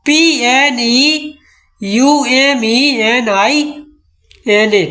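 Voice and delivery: letters spelled out slowly, one by one; slow spelling. Shown as a demonstration. Teacher spelling pneumonia letter by letter for students. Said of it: "जैसे pneumonia लिखो".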